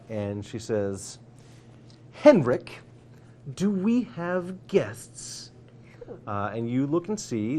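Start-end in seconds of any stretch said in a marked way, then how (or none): 2.1-5.5 higher, slow, stern lady of the house; lady of the manor calls out to Henrik, stern; stern woman. Acted. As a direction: call out sharply like a strict noblewoman. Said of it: "a stern woman's voice".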